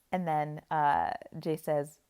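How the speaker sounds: ordinary talk, nothing marked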